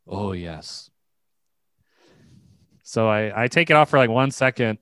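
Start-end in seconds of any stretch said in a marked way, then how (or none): 0.1-0.9 very soft, low, creepy assent; imitated creepy barber. Barber asked to trim a beard, imitated creepy murmur. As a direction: imitate the barber, hushed and deep. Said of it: "And he says".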